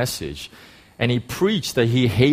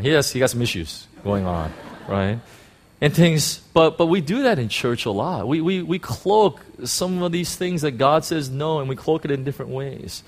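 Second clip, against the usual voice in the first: ordinary talk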